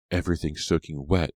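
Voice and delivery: ordinary delivery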